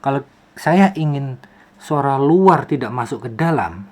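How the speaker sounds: ordinary talk, nothing marked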